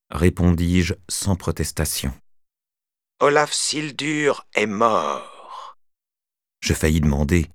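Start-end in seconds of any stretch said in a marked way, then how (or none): 3.2-5.8 put-on slow, cold old man's monotone. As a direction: state it like a verdict, slowly. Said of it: "voix monocorde de vieil homme".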